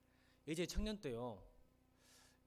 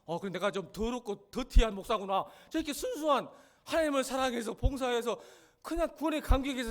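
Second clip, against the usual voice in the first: higher, louder self-mocking sermon outburst; full-force preaching; 7 semitones higher, 10 dB louder than usual. Pastor dramatizes feeling ashamed before congregation, loud preaching.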